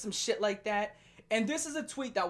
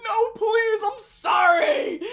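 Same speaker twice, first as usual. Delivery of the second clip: high, loud mock sobbing plea; hammed-up crying plea, much higher and louder; mock begging cry; 13 semitones higher, 10 dB louder, much slower than usual. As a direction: ham up a beaten man begging for mercy. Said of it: "and you're like".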